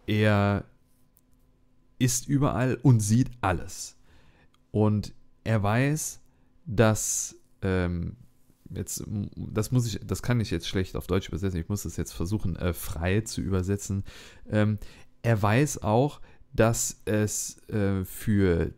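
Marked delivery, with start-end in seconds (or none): none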